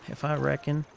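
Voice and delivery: hammed-up Southern hero boast; a clumsy attempt at a Southern accent. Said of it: "He has a deep Southern accent".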